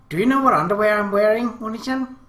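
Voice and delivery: ordinary delivery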